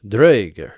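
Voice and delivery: Draugr, R's trilled hard; rolled R. Shown as a demonstration. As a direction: punch out Draugr rolling both R's. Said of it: "rolling the R's".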